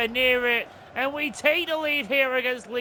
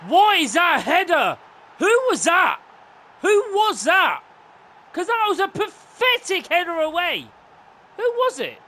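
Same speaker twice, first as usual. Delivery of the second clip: raised, incredulous outburst; exasperated disbelief; 3 semitones higher, 4 dB louder than usual. Real, not acted. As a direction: demand to know who blundered. Complaining about a bad header.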